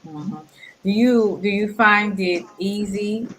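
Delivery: usual speaking voice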